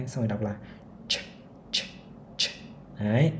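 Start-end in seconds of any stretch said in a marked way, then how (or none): 1.1-2.5 ch with lips pouted forward; rounded ch. Shown as a demonstration. Teacher showing the pouted-lip ch to learners. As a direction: push the lips out and say ch. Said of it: "mồm hơi chu ra".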